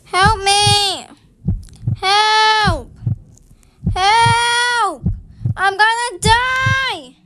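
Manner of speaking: loud, high, drawn-out cries for help; panicked screaming. Real, not acted. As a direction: scream as if about to die.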